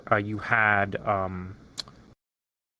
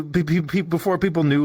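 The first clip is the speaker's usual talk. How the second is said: ordinary talk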